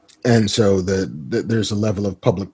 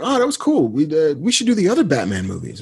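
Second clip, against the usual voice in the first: lightly imitated friend, higher pitch; Kevin impression; 7 semitones higher than usual. Friend proposing more movie commentaries, lightly imitated higher. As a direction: pitch the next idea in a friend's voice. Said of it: "He was like".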